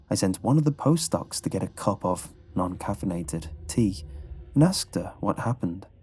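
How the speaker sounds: usual speaking voice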